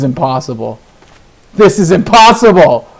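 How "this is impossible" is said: higher, louder burst of disbelief; exasperated shout. Real, not acted. Player fed up with land draws, exasperated shout. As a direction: burst out loudly, pitch jumping up.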